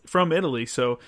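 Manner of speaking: ordinary talk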